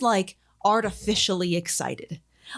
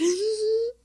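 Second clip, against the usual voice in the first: high, hammed-up shocked gasp; mock startled gasp; 13 semitones higher than usual. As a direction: gasp like a pranked girlfriend. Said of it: "And she's like".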